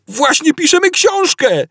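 high desperate yell; Ford yelling. A narrator's reading. Ford at the captain, high panicked yell. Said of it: "darł się Ford".

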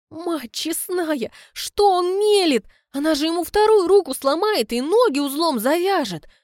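a teacher aghast at her pupil's compliments, high and loud; horrified. Real, not acted. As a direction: gasp in horror at his words. Narrator's horrified exclamation.

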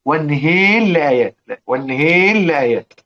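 والنهيل لآيات with the ر merged into a doubled لّ and the vowel tilted toward e; idgham with imala. Shown as a demonstration. Teacher demonstrating والنهيل لآيات with merging and imala. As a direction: say والنهيل as wanaheli llayat. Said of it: "فيها إمالة".

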